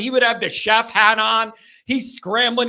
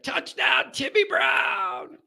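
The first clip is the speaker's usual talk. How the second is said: drawn-out touchdown call, pitched up; Tony Roberts impression; 4 semitones higher, 4 dB quieter, slower than usual. Host recreating a remembered touchdown call, announcer impersonation. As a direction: stretch the name like a stadium announcer. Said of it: "that voice when you hear it".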